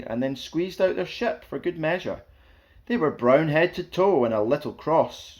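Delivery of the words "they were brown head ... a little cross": ordinary delivery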